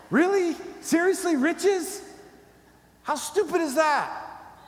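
loud, very high-pitched disbelief; hammed-up indignant scoffing; incredulous attitude. Shown as a demonstration. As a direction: shout it in mock outrage, drawn out. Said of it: "say it with some attitude".

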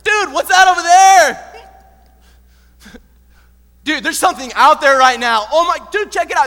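high, very loud startled yell; alarmed shout. Acted. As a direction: shout to the crew about a shocking sight. [name] spots something on the water.

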